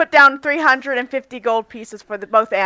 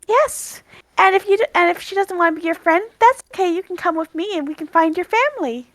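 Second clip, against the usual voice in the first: raised, coaxing voice; gentle Aria; 7 semitones higher than usual. Acted. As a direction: coax a shy animal kindly. Aria reassures the monkey.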